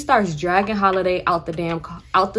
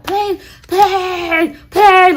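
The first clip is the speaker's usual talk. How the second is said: begging; 11 semitones higher, 8 dB louder, much slower than usual. Retold or imitated. Aunt begs to smoke.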